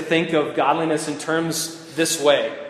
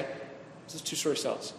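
voiceless, much softer repeat of the question; whispered repeat; 14 dB quieter than usual. Shown as a demonstration. Pastor repeating his question to the group in a whisper. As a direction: drop to a whisper for effect.

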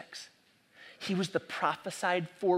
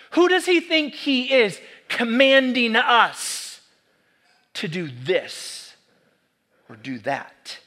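higher, louder, slow protest; indignant question; 4 semitones higher, 6 dB louder, slower than usual. Shown as a demonstration. Preacher voicing a resentful listener, slow and louder. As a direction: demand it like an offended listener. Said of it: "rhetorical indignant question".

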